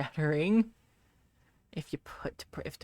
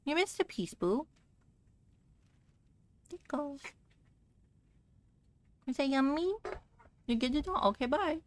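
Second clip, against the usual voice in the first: pet talk; 9 semitones higher, 3 dB quieter than usual. Real, not acted. Cooing at pet on camera.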